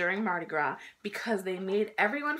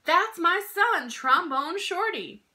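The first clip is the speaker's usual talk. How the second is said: slow, louder, high proud call; proud announcement; 8 semitones higher, 5 dB louder, slower than usual. Acted. Mom holding her son up to the crowd. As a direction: announce him to the whole crowd. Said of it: "held me up in the air and said".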